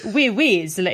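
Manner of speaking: Oui, Weasley with a French lilt; hammed-up French, Oui first then Weasley; French accent. Shown as a demonstration. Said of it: "Just say".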